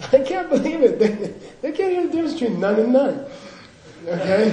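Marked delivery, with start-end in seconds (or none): 0.1-3.3 mock Tibetans laughing, words breaking into laughs. Tibetan monks mock the Americans, laughing. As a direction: play the monks giggling at foreigners. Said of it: "they're laughing at you".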